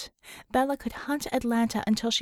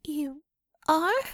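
high, loud disbelieving question; can't believe what she's hearing; incredulous; 6 semitones higher, 3 dB louder than usual. Acted. Einhorn stunned that she's off the case, incredulous. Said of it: "she asked incredulously".

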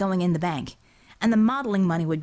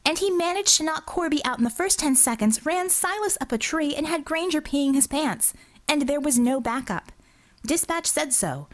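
higher-pitched indignant officer; Bella, indignant; 5 semitones higher than usual. Acted. Officer overrides her boss's objection, indignant. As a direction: cut in and pile up the evidence. Bella argues, interrupting Yankee Pride.

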